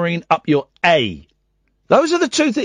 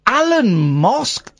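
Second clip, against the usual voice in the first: incredulous; 5 semitones higher, much slower than usual. Real, not acted. Host mocking the name incredulously.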